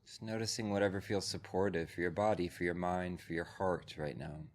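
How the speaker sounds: calm, measured voice with long pauses; guided meditation. Real, not acted. Teacher settles a group into breath and body, guided meditation.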